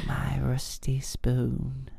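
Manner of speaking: slow, creepy, drawn-out; creepy puppet crooning its treasure; Salad Fingers voice. Acted. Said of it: "my Manchester accent is definitely inspired by salad fingers".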